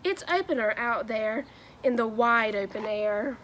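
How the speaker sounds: lively, sing-song picture-book read-aloud; animated Dr. Seuss storytelling with rhyming bounce; reading to children. Acted. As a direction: read it like story time for a class of kids.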